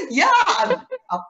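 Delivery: high bursts of real laughter; giggling through the words; laughing. Real, not acted. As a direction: laugh it out, delighted. Laughing about shopping.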